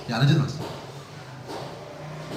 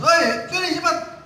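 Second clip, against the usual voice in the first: a much higher, slow, sharp summons; harsh high call; 13 semitones higher, slower than usual. Shown as a demonstration. An impatient parent calls a child over, high and harsh. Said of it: "dengan kuat suara keras".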